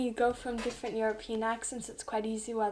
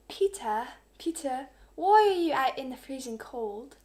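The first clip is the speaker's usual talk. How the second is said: higher, louder UK-accented demo line; 3 semitones higher, 5 dB louder than usual. Shown as a demonstration. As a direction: put on a UK accent to show it off. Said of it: "a U K accent, then to a French".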